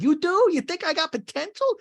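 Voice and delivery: very high, hammed-up surprise; astonished disbelief. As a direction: squeal it as a flattered friend. Kit astonished at praise, acted.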